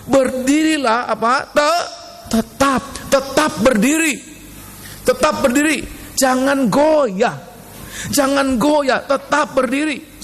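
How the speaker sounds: high, drawn-out sermon command; preaching. Real, not acted. Preacher urging the congregation to stand firm, preaching high. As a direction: ring out each phrase high and slow.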